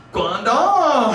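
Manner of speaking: exaggerated Simlish date greeting. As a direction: flirt in nonsense Simlish game-speak. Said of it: "The only thing I can speak fluently is Simlish".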